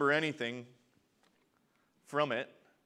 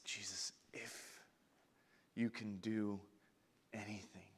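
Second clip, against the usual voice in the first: hushed plea; 5 semitones lower, 11 dB quieter than usual. Acted. Father's plea, read softly.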